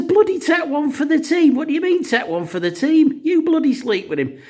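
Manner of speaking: high, loud indignant wife voice; wife throwing his words back at him, shrill with outrage; outraged wife. Acted. Wife refuses husband's team request.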